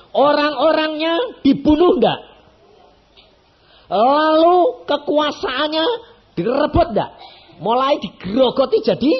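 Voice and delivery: high, loud pulpit build-up; soaring sermon peak; preaching at full force. Acted. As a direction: drive the point home to the congregation. Rhetorical questions to congregation.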